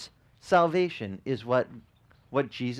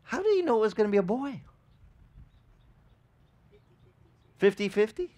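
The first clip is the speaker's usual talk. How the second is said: higher, faster mock-doubtful question; doubtful skeptic; 8 semitones higher, faster than usual. The preacher doubts the angel's word, a higher, faster mock-sceptical question. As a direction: poke at it like a sceptic.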